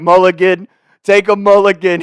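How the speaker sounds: high, loud call-out; imitated pleading shout. Friends dying laughing offer the angry golfer a redo, shouted. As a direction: call it out high over the commotion.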